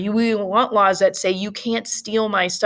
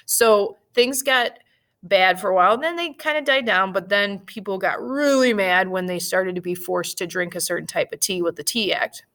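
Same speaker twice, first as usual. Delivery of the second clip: ordinary delivery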